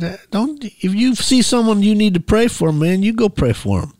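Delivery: high-pitched mock protest, passing the job on. Man tells his friend to go instead, protesting. Retold argument over who should go.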